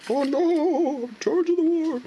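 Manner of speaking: cartoon shriek of dismay; dismayed General Grant. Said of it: "Dispatch from Lincoln".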